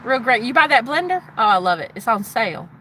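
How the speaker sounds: put-on Southern US chat; friendly small talk with a Southern twang; southern drawl. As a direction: greet folks in a playful southern drawl. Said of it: "I gotta go in with my southern drawl".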